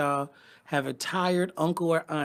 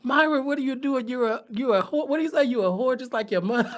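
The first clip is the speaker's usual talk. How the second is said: mock scolding father; 7 semitones higher, 6 dB louder than usual. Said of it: "He was like".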